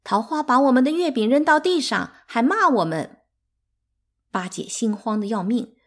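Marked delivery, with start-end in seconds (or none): 0.0-3.2 put-on high, loud childish grievance; upset child telling on someone; flustered complaint. A narrator's reading. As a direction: blurt out the complaint, pitched up. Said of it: "不知所措地抱怨说".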